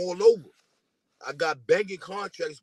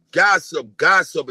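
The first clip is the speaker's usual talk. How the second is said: mocking loud repeat; 3 semitones lower, 12 dB louder, slower than usual. Real, not acted. Mocking rival as gossip.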